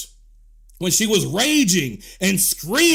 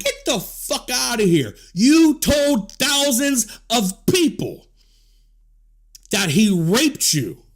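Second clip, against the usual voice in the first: furious shout; 3 semitones higher, slower than usual. Real, not acted. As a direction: hammer each word at her, slowly. Furious rant at her.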